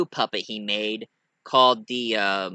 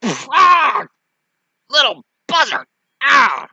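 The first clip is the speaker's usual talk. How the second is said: high, loud hammed-up cry; cartoonish outraged yelps; pained yell; 14 semitones higher, 9 dB louder, slower than usual. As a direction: yell out as if punched. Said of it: "Pinhead punched him".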